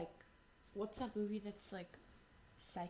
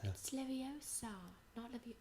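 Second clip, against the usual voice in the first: Hermione; 9 dB quieter than usual. Acted. Attempting the famous correction line, Hermione impression. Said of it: "can someone do a Hermione line".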